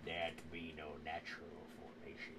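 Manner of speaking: put-on low, quiet old sea dog; old sailor. Cook continues.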